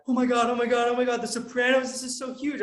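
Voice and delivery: breathless run of starstruck oh-my-Gods; freaking out. Retold or imitated. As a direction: imitate people losing it with excitement. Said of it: "they would start freaking out".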